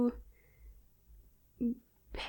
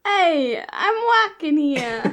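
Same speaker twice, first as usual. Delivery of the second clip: hammed-up New York "I'm walking here"; a loud, mock Brooklyn street call; Brooklyn accent; 3 semitones higher, 5 dB louder, slower than usual. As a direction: Brooklyn tough guy, half joking. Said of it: "Brooklyn accent".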